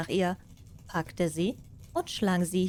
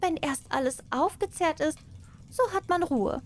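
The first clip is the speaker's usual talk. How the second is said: cat to herself; 8 semitones higher than usual. Acted. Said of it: "sagte sie zu sich selbst".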